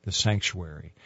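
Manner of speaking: low, softened voice imitating church quiet; lightly acted near-whisper, low and soft; hushed church voice. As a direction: drop into a hush, as if entering a sanctuary. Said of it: "we always had to whisper".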